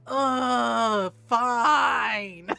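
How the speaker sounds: very loud, drawn-out mock groan; exasperated. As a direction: give in like a hugely put-upon kid. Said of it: "the exasperated side".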